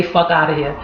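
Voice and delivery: New Yorker who isn't going along with it; dismissive. Shown as a demonstration. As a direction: wave the idea away. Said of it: "a reaction that it's like, you not with it".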